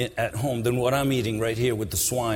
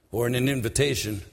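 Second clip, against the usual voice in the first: usual speaking voice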